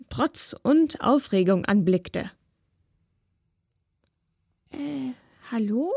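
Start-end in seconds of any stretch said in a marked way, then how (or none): 4.7-5.9 a bit confused. A narrator's reading. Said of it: "sprach die Wächterin sie etwas verwirrt an".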